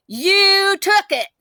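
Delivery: high loud slow shriek. A narrator's reading. Girl shrieking at her sister. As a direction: shriek the accusation. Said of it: "I shrieked".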